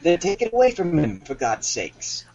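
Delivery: high start, slowing as it drops; impatient captain. Acted. Captain ordering his steward to act, exasperated. Captain's part in acted scene.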